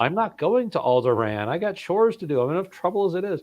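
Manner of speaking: high-pitched mock whining hero; reluctant hero. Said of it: "the reluctant hero thing".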